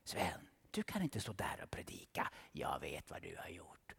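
low, tempting whisper; devil whispering. Acted. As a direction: whisper it like a sly accuser. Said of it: "Då viskar han".

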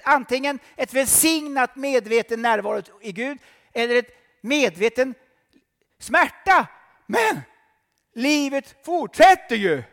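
high, loud fervent preaching; full-force preaching. Acted. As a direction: preach it to the hall.

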